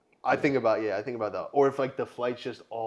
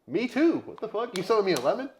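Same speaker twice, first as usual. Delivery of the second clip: high outraged; 10 semitones higher, 4 dB louder than usual. Retold or imitated. Quoted reply to grandma.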